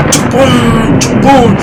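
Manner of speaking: loud, high booming lightning noise; imitated thunder crash. A narrator's reading. Storyteller voicing the lightning in the storm, imitated thunder crash. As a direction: make the thunderbolts crash with your voice.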